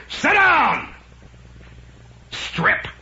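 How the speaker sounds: loud, high barked order; Casey. Acted. TV doctor silencing a hysterical patient, mock shout. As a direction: cut the patient off with a yell. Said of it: "Casey's always yelling at people".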